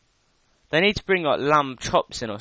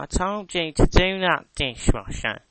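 nagging mum; 6 semitones higher, 7 dB louder than usual. Retold or imitated. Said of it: "she'll be like".

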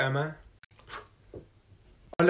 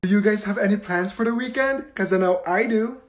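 ordinary talk, nothing marked

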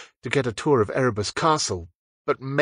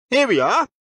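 brisk; 12 semitones higher, 4 dB louder than usual. Acted. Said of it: "Percy said briskly".

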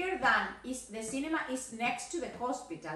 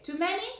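too many, many pitched higher with a rise; stress on many; 7 semitones higher, faster than usual. Shown as a demonstration. Teacher contrasting too many, many raised high.

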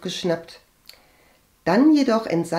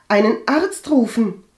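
loud, higher, heated answer; agitated retort; 6 semitones higher, 7 dB louder than usual. A narrator's reading. Agathe answers her callous cousin, agitated. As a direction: fire it back crossly. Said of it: "gab Agathe aufgebracht zurück".

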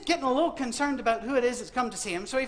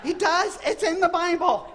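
emphatic; 8 semitones higher, 6 dB louder, slower than usual. Real, not acted. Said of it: "emphatic insistence".

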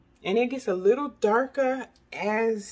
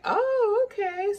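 mock surprised oh; 12 semitones higher, 4 dB louder, slower than usual. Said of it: "she was like".

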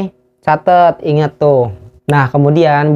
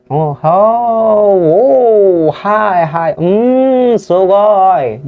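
mock nodding along; 6 semitones higher, much slower than usual. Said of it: "Aku aja".

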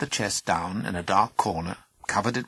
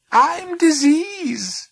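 creepy creature voice; 9 dB louder, much slower than usual. Acted. Creatures announce themselves.

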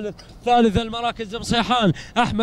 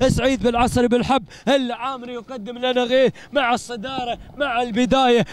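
ordinary talk

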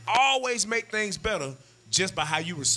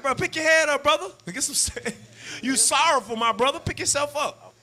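urging a friend; 9 semitones higher than usual. Acted. Urging the sad brother up.

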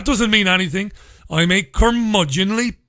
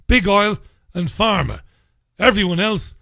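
usual speaking voice

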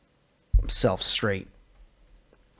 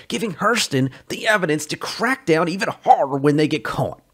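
louder, higher rising reproach; heated lecture; 5 semitones higher, 6 dB louder than usual. Acted. Joe scolds Sully loudly over the smuggling. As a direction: lay into him with building anger.

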